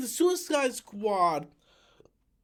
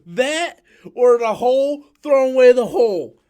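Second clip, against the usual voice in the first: angry rant; 3 semitones higher, 10 dB louder than usual. Real, not acted. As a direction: hammer each word out in disgust. Angry rant about the movie.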